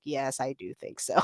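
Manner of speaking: low, quiet, dry agreement; deadpan sarcasm. Retold or imitated. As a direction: drop the voice and flatly concede. Sarcastic reply to boss.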